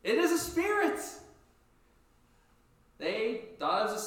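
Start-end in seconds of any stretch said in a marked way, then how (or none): 0.0-1.0 much higher, louder fearful cry; frightened cry. Acted. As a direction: cry out like terrified fishermen.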